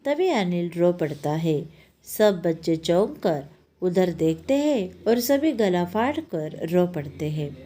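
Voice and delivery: usual speaking voice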